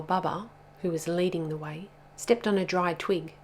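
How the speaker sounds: usual speaking voice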